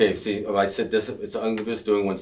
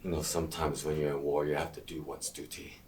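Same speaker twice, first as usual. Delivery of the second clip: quiet; 4 semitones lower, 8 dB quieter than usual. Acted. Cold villain after shouting.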